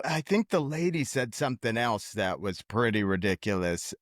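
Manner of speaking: normal talking voice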